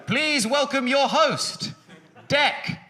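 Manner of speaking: high, slow compère call; mock announcer. Comic introducing himself in a high, slow announcer voice. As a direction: announce the host like a showman.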